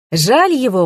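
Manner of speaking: high exclamation of pity; exclaiming. A narrator's reading. Investigator hears of the husband's death, exclaiming. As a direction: exclaim it with lifted pitch. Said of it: "воскликнул Сеня".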